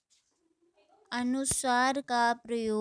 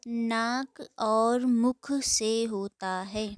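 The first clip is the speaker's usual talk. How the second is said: dictation pace; 5 dB louder, slower than usual. Shown as a demonstration. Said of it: "dictating definition slowly".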